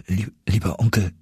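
halting, broken first word; stammering. A narrator's reading. Grateful nephew addresses his uncle, moved stammer. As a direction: stumble on the first syllable, moved. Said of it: "stammelte ich".

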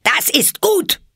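very high, loud shriek; screaming. Acted. Queen answers her soldiers, screaming. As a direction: shriek your approval. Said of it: "schrie die Königin".

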